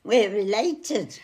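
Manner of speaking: lively party cheer with a jump in pitch. Pretend. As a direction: say it like you're having a party. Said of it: "like you're having a party".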